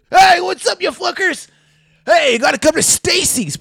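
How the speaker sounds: high, loud hollered greeting; mock calling out. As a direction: holler down the phone as a hyped-up dad. Dad phones them, calling out.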